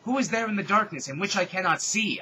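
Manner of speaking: loud, high calm call into the dark; serene yell. Acted. Heroine calling into the darkness, loud but serene. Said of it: "I yelled serenely".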